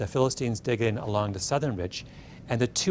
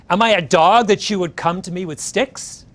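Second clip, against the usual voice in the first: retold insulted retort; 7 semitones higher, 8 dB louder than usual. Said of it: "he's insulted".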